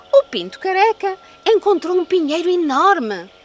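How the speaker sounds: ordinary delivery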